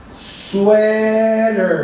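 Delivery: "sweaters" ending in a voiced z; "sweaters", buzz the final z; z sound. Shown as a demonstration. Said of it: "Pronunciation is".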